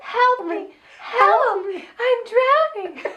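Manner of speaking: high slow squeaky plea with laughter; drowning cry. Retold or imitated. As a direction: wail for help, laughing, dragging it out. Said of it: "Is she saying "drowning"?".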